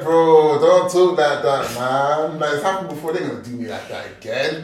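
loud, drawn-out plea; slow mock-dismayed outcry; acted protest. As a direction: beg a friend to stop, stretching the words. Retold plea to his friend.

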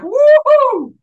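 loud, very high-pitched whoop; excited whoop. Retold or imitated. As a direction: cheer it out, high and full-voiced. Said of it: "And I'm like".

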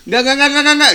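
high, loud, rapid no no no; loud refusal. Retold or imitated. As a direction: shut it down loudly. Retold refusing the music.

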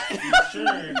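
high laughing yell; laughing shout. Real, not acted. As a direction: blurt it out through laughter.

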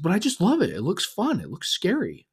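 imitating an insistent little kid, high pitched; high child voice making his case; pleading young son. Son insists to dad he loves it, pleading kid voice. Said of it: "And he's like".

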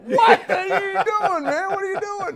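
very high, slow squeal through laughter; buddy gaping from the stairs, squeaking through laughter; laughing disbelief. Retold or imitated. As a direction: imitate the stunned buddy while cracking up. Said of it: "My buddy's going".

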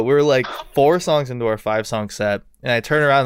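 normal talking voice

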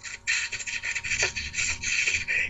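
rapid voiceless puffing bursts; imitated drunken noise. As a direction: act out a stuttering string of breath noises. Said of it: "all you hear me doing is".

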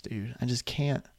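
ordinary talk

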